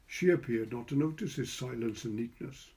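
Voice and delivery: ordinary delivery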